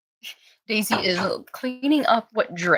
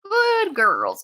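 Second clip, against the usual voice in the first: squeaky sing-song praise for the puppies, much higher; high puppy praise voice; 9 semitones higher than usual. Real, not acted.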